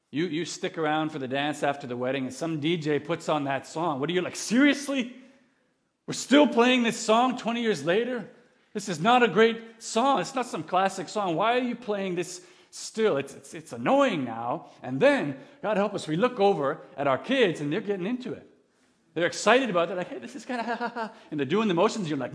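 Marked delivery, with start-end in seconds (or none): 4.3-13.2 high-pitched, louder exasperated protest; fed-up wedding guest, voice pitched up; exasperated disbelief. Acted. 20.1-21.1 put-on high-pitched kid voice with laughter; mock childlike giggling, pitched up; imitating excited kids.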